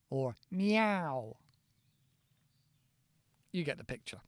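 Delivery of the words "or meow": mock meow. Shown as a demonstration. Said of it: "For example".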